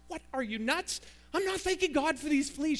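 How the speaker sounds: high-pitched, scandalized protest; acted outraged refusal; indignant disbelief. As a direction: balk at an absurd request.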